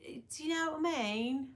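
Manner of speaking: playful British imitation; British lilt, rising at the end; British accent. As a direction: softly mimic a British turn of phrase. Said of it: "all day we'll walk around and be like".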